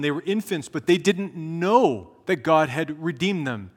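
loud, rising preacher's climax; preaching at full force. Acted. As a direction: build the sermon up to a loud peak. Preaching climax on God roaring.

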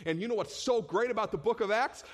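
normal talking voice

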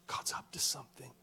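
soft whispered secret; awed whisper. Real, not acted. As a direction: whisper it with awe. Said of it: "whispered awe".

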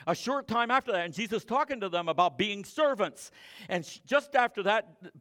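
loud, high-pitched preaching; fiery pulpit preaching; full-force preaching. Acted. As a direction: drive the sermon home to the pews. Preaching to the congregation.

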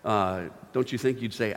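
usual speaking voice

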